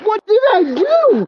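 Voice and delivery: high, loud pleading yell; shouting. Real, not acted. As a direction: yell it in disbelief. Man yells back at officer.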